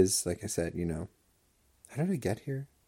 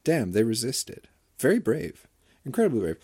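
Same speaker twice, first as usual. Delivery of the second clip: mock admiration; 6 semitones higher, 8 dB louder than usual. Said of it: "You can be like".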